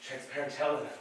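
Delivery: ordinary talk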